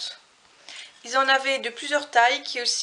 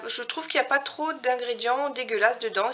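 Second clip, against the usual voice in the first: ordinary delivery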